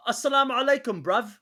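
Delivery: high, loud imitation of a London-accented Arabic greeting. Mocking how English Muslims talk, London accent. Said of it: "when they speak Arabic with their accent".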